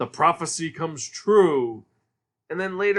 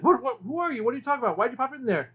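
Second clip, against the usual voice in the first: put-on high, panicky kid voice; a high, flustered young boy; startled cartoon boy; 7 semitones higher, faster than usual. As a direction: squeal it like a spooked little boy. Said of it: "And he's like".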